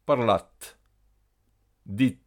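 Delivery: "Parlat" and "Dit" with a sounded final T; final T only. Shown as a demonstration. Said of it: "on n'entend que le T". (« Parlat », « Dit » avec T final sonore)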